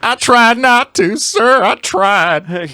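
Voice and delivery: high, slow mock Southern drawl; Southern accent. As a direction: play a thick Southern yokel.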